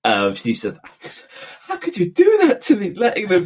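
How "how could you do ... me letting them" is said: high-pitched mock outcry, imitating a reproachful mother; horrified mother. Parent imitating their mother's complaint about the swimming, horrified. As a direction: imitate an appalled mum, voice shooting right up.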